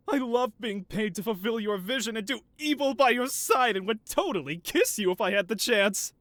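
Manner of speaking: mock gushing henchman. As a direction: gush like a fawning sidekick praising the boss. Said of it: "Here, I'll start for you".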